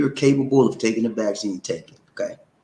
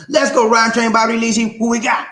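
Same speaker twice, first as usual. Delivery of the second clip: high, loud roll call; host call-out; 11 semitones higher, 4 dB louder than usual. Real, not acted. Host asks the crew who's next, high call-out. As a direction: throw it out to the crew, pitched way up.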